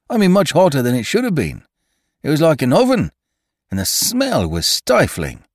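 high, deferential servant voice; a nervous old gardener pleading; humble gardener voice. A narrator's reading.

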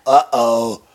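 wild Tasmanian devil growl and gibberish; Taz impression. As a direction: snarl it out like Taz spinning in. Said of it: "Can you do your best Taz impression?".

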